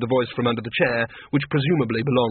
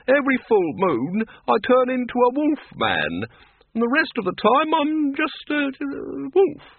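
high-pitched, animated complaint; exasperated wolf-man; 13 semitones higher than usual. Acted. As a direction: explain your absurd plight, pitched well up. Voices Lupine explaining himself.

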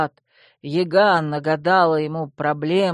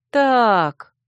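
startled; 6 semitones higher, 6 dB louder than usual. A narrator's reading. Startled heroine stops him.

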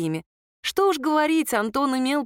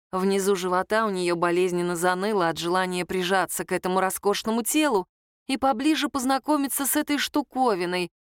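usual speaking voice

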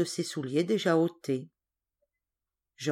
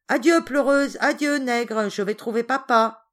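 loud, high-pitched taunt; crowing, spiteful farewell; mean, gloating cry; 4 semitones higher, 6 dB louder than usual. Acted. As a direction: jeer at them as you leave. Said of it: "s'écria Georges en riant d'un air méchant".

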